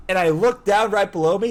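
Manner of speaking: usual speaking voice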